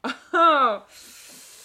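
high, loud burst falling away; loud laugh. Real, not acted. Hearing own rude margin note read out, loud laugh. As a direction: laugh out loud at being caught.

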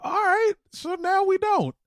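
mock sulk. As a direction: pout like a jilted friend. Mock sulking, very high.

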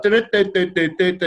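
hummed conga music mimicry; conga tune. Bringing balls out to conga music, hummed tune. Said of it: "So it was like".

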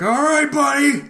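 high put-on shout, laughing through it; mock yell. Clerk yelling at the shoplifter to return the stolen goods, high laughing mock shout. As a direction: yell at the thief, hammed up.

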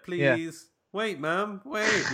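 high whiny plea for mum, breaking into a laugh; mock childish plea. Podcaster mocking Jim calling after Selena, high whiny plea. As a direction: whine like a toddler left behind. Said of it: "shouting for her".

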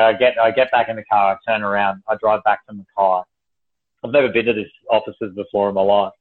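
ordinary talk

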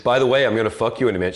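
high, loud, fast sneering jock; mock the rival with a smug sneer; cocky cool guy. Voicing the cool motorcycle guy.

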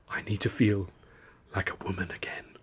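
soft whispered murmur. Acted. Wren murmurs to Arthur in the dark, whispered. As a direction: whisper it close to his ear. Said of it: "she murmured".

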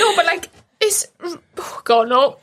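halting through tears with a small laugh; choked up, crying. Real, not acted. Friend overcome while praising her friend's recovery, choked up, crying. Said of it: "I said I'd cry".

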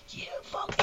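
whispered mock outburst; retold going off. Re-enacting the punter going off, whispered swearing. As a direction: act out the rant with the voice taken out. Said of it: "you know, going off".

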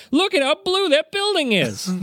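high, airheaded mock gushing; mocking impression. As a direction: parrot your friend's starry-eyed voice.

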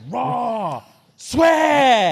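high, loud shocked yelp; shocked outcry. Pretend. Referee hearing the crowd chant, shocked high yelp.

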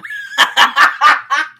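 big, high burst of real laughter; loud burst of laughter. Real, not acted. Friend cracking up at the bad line, real laughter. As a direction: crack up loudly. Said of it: "Sounds like he has, like, eggs".

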